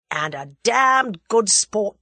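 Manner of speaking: slow, loud, relishing emphasis; emphatic, savoured approval; hearty agreement. A narrator's reading. Tommy heartily agrees, happy ending.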